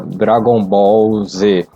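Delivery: ordinary talk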